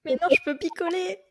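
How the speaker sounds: high-pitched joking objection; mock protest. Joking as the newly pregnant woman who wants to drink, mock protest.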